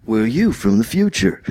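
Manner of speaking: lightly voiced movie dude; a hint of slacker movie quote; Bill and Ted. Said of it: "when their past selves appears".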